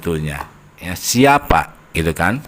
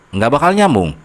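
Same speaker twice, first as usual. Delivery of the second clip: ordinary talk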